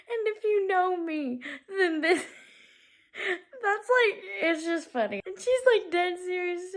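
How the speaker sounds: can barely get words out for laughing; laughing so hard. Real, not acted. Telling viewers about the scene, cracking up.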